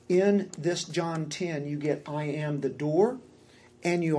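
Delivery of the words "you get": normal talking voice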